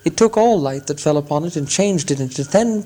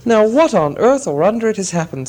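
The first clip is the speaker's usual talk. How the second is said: a lightly voiced dwarf king, pitched up and puzzled; a hint of an alarmed dwarf leader; mildly raised, puzzled dwarf voice; Thorin; 7 semitones higher than usual. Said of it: "said Thorin".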